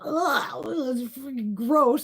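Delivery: high, slow disgusted groan. Retold or imitated. Kid tasting the cough syrup, slow and disgusted. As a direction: drag it out in disgust. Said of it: "you're like".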